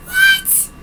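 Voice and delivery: very high shocked What; shocked shriek. Acted. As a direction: squeal it in disbelief. Shocked reaction.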